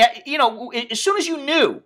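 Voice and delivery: ordinary talk, nothing marked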